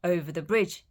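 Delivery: ordinary delivery, nothing marked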